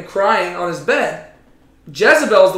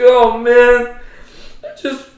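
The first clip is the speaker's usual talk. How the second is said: higher, whiny mock lament; mopey president; 4 semitones higher, 6 dB louder than usual. Imagined president whining about a tree, hammed-up. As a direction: sulk like a spoiled kid denied a toy. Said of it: "saw Joe Biden just, like, curl down".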